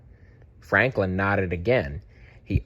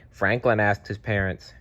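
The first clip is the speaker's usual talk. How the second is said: ordinary delivery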